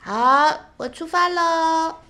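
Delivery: put-on high, loud, slow childlike reply; cheerful little child. Little Atchan answering his mother, high cheerful child voice. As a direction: sing out the answer like an eager kid.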